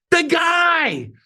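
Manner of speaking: high mock holler with a long fall; insistent calling out. Receptionist reminds her coworker who is calling, mock insistent holler. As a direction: imitate an exasperated call to a coworker. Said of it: "She muffles the phone, but I could still hear".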